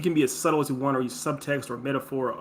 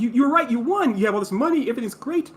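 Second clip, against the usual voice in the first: much higher, louder acted mother; exasperated mom; 9 semitones higher, 4 dB louder than usual. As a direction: mom conceding the point in a high voice. Acting out the worked-up mom.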